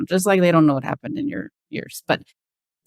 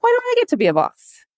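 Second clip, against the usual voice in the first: light, high and friendly, no edge; nice, playful question rather than a complaint; faster than usual. Retold or imitated. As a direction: ask it sweetly, a gentle tease. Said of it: "in a nice way".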